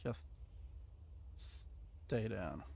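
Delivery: soft, low finishing command; quiet grim command. Real, not acted. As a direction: mutter it to the fallen zombie. Finishing off a zombie.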